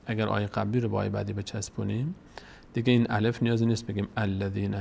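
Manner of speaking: ordinary talk, nothing marked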